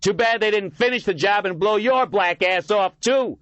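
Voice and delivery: put-on high, loud drunken yelling. A narrator's reading. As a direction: yell it back, drunk and vicious. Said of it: "the drunken man yelled back".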